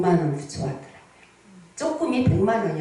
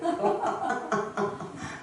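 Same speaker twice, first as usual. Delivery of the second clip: laugh; 6 semitones higher, 8 dB quieter than usual. Real, not acted. Laughing at the gift.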